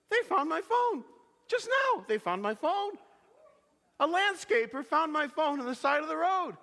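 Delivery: high, excited news-breaking; acted amazement. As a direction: imitate the thrilled hairstylist, pitch jumping up. Acts hairstylist announcing found phone.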